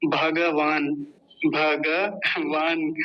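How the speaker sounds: भगवान with a full ga, bha-ga-vaan; Sanskrit pronunciation. Shown as a demonstration. Teacher contrasting Sanskrit with Hindi, full-vowel pronunciation. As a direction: say भगवान slowly, sounding every syllable. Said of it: "Sanskrit में क्या बोलेंगे".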